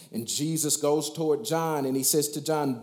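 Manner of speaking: normal talking voice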